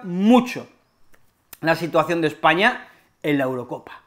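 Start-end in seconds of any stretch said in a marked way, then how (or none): none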